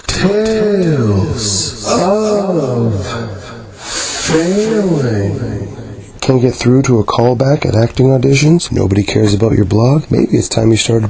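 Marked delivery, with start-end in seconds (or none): 0.1-5.4 long, drawn-out high swoops on each word; grand, slow, swooping title call; show title announcement. Acted. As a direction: announce the title theatrically, stretching every word.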